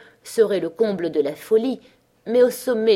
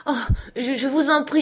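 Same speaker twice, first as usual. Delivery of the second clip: high, louder stammering plea; pained plea; 7 semitones higher, 3 dB louder than usual. A narrator's reading. Cousin begging her cousin for money, pained stammering plea. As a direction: beg through the pain, stumbling. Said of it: "poussa une sorte de cri de souffrance".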